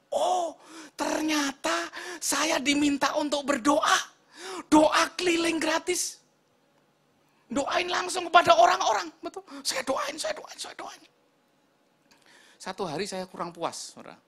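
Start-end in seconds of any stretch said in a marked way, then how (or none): 0.1-11.0 high, loud, excited sermon delivery; full-force preaching. Real, not acted. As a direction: cry out the revelation to the congregation.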